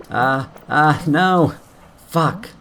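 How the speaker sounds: sudden high alarmed yelp; real panic as the jump fails; yelped no, then a curse; panicked cry. Real, not acted. Platforming fail, sudden panic.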